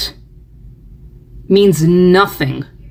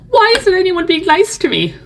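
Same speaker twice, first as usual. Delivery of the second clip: much higher whiny voice; mock whine; 12 semitones higher than usual. Mocking apologetic posters who whine for kindness. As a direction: whine it like a spoiled child. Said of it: "then being like".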